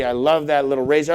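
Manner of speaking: ordinary talk, nothing marked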